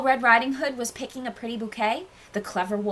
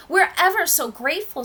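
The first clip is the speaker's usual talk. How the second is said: put-on grateful child; 8 semitones higher, 5 dB louder than usual. A narrator's reading. Rescued child thanks the hunter.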